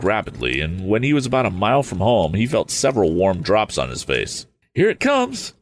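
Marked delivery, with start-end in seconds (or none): 4.7-5.5 high-pitched excited cry; youth's eager exclamation, pitched way up; exclaiming. A narrator's reading. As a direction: call it out in a leaping high pitch. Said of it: "exclaimed the youth".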